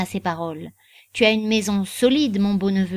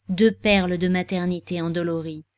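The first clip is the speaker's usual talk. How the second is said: usual speaking voice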